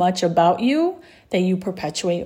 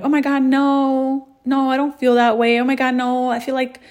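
higher, acted protest at a compliment; self-deprecating; 5 semitones higher than usual. Woman turning down a compliment, self-deprecating. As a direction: brush off praise in a put-on higher voice. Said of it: "Self-depreciation".

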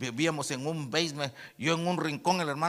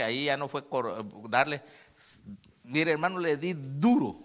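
normal talking voice

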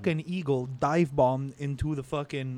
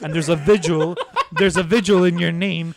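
louder, laughing through the words; laughing while talking; 3 semitones higher, 6 dB louder than usual. Real, not acted. Friend tells the absurd shark story, laughing. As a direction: laugh out the punchline.

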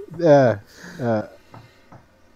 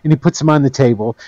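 ordinary talk

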